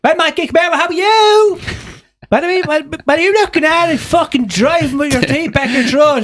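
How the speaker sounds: very high, hammed-up Dublin accent; put-on Dublin accent. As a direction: screech it in a mock Dublin accent. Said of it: "what about this accent?".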